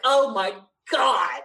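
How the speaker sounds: drawn-out exasperated groan of words; retold exasperation. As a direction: stretch it out slowly in mock agony. Retold reaction to game news.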